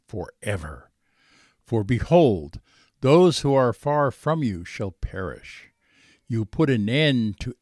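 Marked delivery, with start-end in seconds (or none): none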